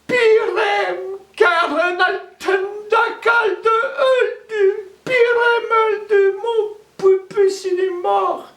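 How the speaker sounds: high, loud sung wail of grief; keening lament. Acted. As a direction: wail for the dead husband. Widow laments dead husband.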